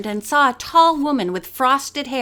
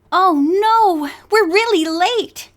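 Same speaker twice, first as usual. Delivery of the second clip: dismayed; 7 semitones higher, 4 dB louder, slower than usual. Acted. Bess realizes they're late.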